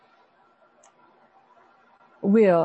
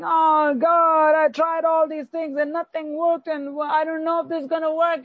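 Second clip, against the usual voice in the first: mock self-pitying moan to God, higher; 5 semitones higher than usual. Preacher imitates a believer wallowing in self-pity before God. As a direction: lightly act out a pitiful lament. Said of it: "deep hole of self-pity and saying".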